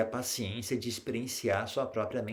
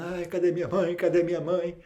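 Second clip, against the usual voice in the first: put-on high, whiny voice of a lost child calling for mum; lost child crying; 6 semitones higher, 4 dB louder than usual. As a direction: play the scared little kid who lost his mother. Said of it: "Ela tá ali chorando". (criança perdida choramingando, voz aguda)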